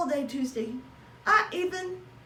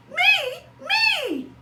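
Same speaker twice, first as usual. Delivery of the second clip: much higher, louder cry; overjoyed squeal; 7 dB louder than usual. Acted. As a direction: squeal it in disbelieving joy. Hamster astonished, overjoyed.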